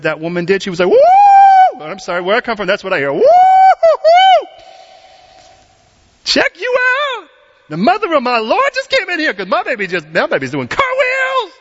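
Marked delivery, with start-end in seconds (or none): 0.9-1.8 high, loud shouted woo; joyful whoop. Retold or imitated. Elizabeth whooping as Mary arrives, high joyous cry. 3.1-11.5 high, loud, stretched-out joyful cries; elated Elizabeth. Retold or imitated. Elizabeth welcoming Mary, shrieking with joy.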